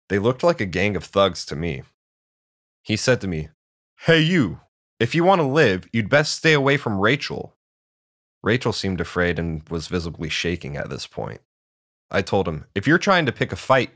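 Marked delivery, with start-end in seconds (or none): 4.0-7.6 higher, louder warning to back off; threatening bully. A narrator's reading. Bully threatens the new boy. 12.7-13.9 higher, louder, standing up to a bully; defiant challenge. A narrator's reading.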